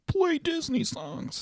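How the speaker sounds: high, drawn-out mimicry of coworkers; mock their request in a slow, soft high voice; put-on squeal of coworker enthusiasm, kept soft.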